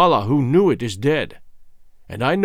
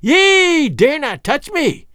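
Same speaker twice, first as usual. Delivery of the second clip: high, slow, loud witch's shriek; old witch screaming at the king; furious shriek; 11 semitones higher, 4 dB louder, slower than usual. A narrator's reading. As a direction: shriek it in terror and rage. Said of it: "she shrieked in terror and fury".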